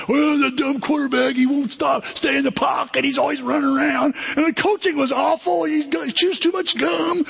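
high-pitched mock yelling; whiny finger-pointing rant, played up; yelling blame. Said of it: "Everybody's yelling and pointing fingers".

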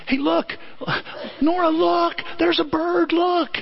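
high, eager pointing voice; excited mother. Retold or imitated. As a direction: get the baby to look, full of excitement. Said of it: "And Nicole goes".

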